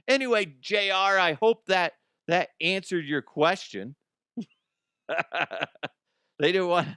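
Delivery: ordinary delivery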